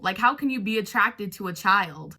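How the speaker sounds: ordinary delivery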